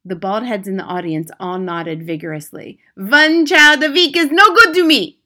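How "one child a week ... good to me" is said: put-on high, loud accented complaint; Grand High Witch crying out. A narrator's reading. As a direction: cry out like a witch in a rage. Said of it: "the Grand High Witch cried out".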